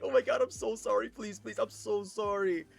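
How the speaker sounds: high, urgent begging; desperate mock pleading, slowing at the end; pleading apology. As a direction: imitate a kid begging forgiveness. Begging the crying girl's forgiveness.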